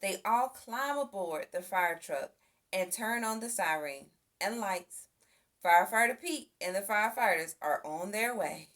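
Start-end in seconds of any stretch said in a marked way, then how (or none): none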